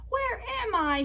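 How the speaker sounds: high-pitched small storybook voice; wondering little sweet potato voice; little lost character. Acted. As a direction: ask it high, like a tiny lost creature.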